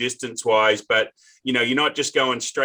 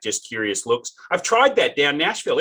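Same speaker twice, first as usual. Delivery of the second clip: ordinary talk, nothing marked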